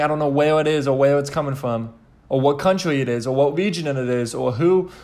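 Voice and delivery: normal talking voice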